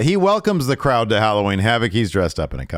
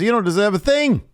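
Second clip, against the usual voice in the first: high, loud taunt; mock heckling wrestler; 4 dB louder than usual. Wrestler interrupting the champion's speech, high mock taunt. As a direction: imitate a heckler pitched way up.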